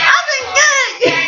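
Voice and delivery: the voice shoots up high from nerves; voice jumping up. Retold or imitated. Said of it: "Like, my voice went up".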